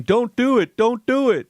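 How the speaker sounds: high-pitched imitated pleading; mock warning. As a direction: imitate onlookers urging her to stop. Retold onlookers warning against it.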